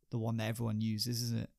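ordinary delivery

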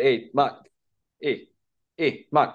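imitated Scottish driver calling out. Driver hailing his passenger in the morning, mock Scottish accent. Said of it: "drivers who kind of picked us up in Scotland".

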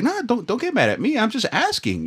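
high, soft, backing off; high, soft placating. Retold or imitated. Telling the lady not to get mad, high and soft. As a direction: placate her in a small high voice. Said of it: "I was like".

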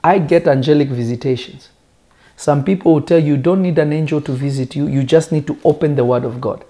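ordinary delivery

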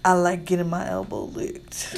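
low sultry phone-sex voice; mock seductive. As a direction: purr it like a sex line operator. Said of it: "say it sexy".

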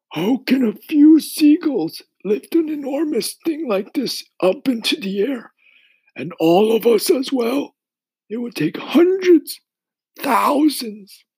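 put-on high, loud old insect voice; old grasshopper voice. A narrator's reading.

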